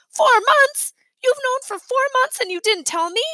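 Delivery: put-on a boy betrayed by his parents; outraged disbelief. A narrator's reading.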